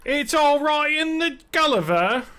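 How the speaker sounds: high, loud hammed-up British voice; mock British accent. Joking about Brit viewers, mock British accent.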